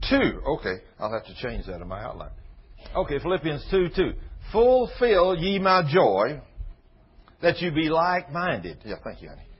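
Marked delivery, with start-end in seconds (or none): 4.5-8.8 slow, emphatic reading of scripture. Acted. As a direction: read the verse out slowly and heavily. Reads scripture slowly.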